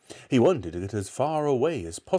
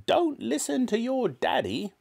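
high, commanding granny voice; bossy grandmother. A narrator's reading. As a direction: order the boy about as a mean old woman. Grandmother ordering George about.

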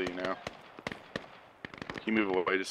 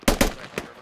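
urgent hushed callout, louder; whispered callout; 8 dB louder than usual. Real, not acted. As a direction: hiss the warning out. Repeated callout in combat.

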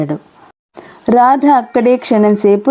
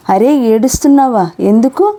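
ordinary delivery, nothing marked

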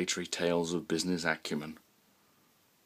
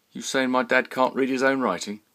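loud, higher confrontational challenge; belligerent teen; 7 semitones higher, 6 dB louder than usual. Acted. Son defending his father to Roy, loud and belligerent. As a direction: square up to him, defending dad.

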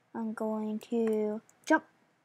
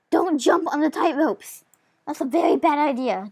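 loud warning; 4 semitones higher, 6 dB louder than usual. Acted.

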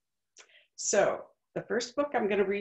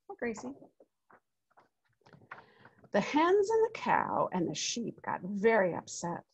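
put-on lively read-aloud voice for children; story narration; 4 dB louder than usual. A narrator's reading. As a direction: tell it like a picture book to a class. Reads picture book aloud.

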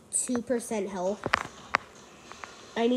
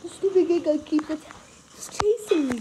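being chased; 5 semitones higher, 3 dB louder than usual. Real, not acted. Still fleeing the Giga.

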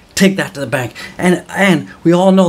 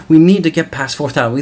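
normal talking voice

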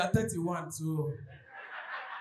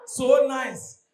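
normal talking voice

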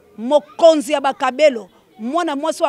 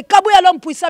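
loud, raised hail; hyping shout-out; 7 semitones higher, 8 dB louder than usual. Real, not acted. Host hailing the powerful man, hyping shout-out. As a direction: call his name out high and loud.

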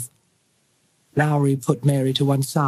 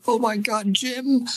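sobbing; 7 semitones higher than usual. Acted. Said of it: "she sobbed".

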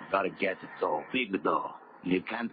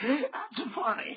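slow, mocking laugh through the words; scoffing at his friend's farm dream, laughing slowly; 3 dB quieter, much slower than usual. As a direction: laugh him off, drawn out.

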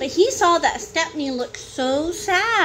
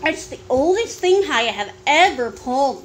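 ordinary delivery

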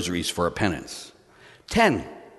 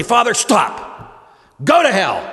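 high, loud outburst with a drawn-out stop; firm, loud rebuff; 10 semitones higher, 6 dB louder, much slower than usual. Acted. Penitent to a screaming priest in confession, loud and defiant. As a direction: shout it like halting a screaming priest.

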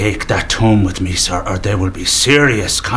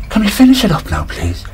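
much higher, pleading tone; high-pitched voice; 11 semitones higher, faster than usual. Acted. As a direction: ask it up in a high voice. Second bouncer, high voice.

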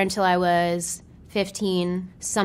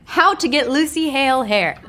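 louder re-read; 6 semitones higher, 5 dB louder than usual. Real, not acted.

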